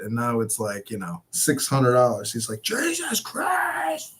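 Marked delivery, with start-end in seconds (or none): 2.6-4.1 slow, much higher groan of disbelief; dad's exasperation. Retold or imitated. Dad hearing the ticket doubled, slow high exasperation. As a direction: stretch it out like a stunned father.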